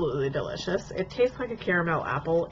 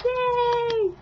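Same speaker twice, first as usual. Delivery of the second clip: excited cheer; 4 dB louder than usual. Real, not acted. Announcing birthday shout-outs.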